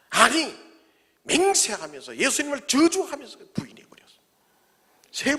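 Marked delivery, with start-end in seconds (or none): none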